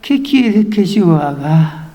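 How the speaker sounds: low, slow, submissive question; humbled. Acted. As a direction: surrender meekly, drawing the words out. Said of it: "Ya lo vemos bajando la cabeza".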